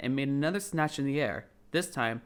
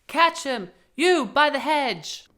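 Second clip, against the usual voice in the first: high, loud command, slowed at the end; shouted order; 12 semitones higher, 8 dB louder, slower than usual. Acted. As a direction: yell across the garden. Rabbit orders a helper.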